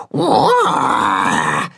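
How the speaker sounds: low mock roar; small put-on roar; calf roar. A narrator's reading. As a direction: roar like a little beast. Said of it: "brüllt Tacho".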